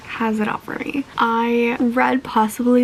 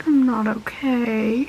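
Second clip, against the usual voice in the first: ordinary talk, nothing marked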